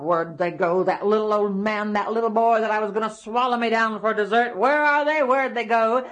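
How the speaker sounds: grouse angrily as you search; grumbling giant. Acted. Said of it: "he was mumbling and grumbling to himself".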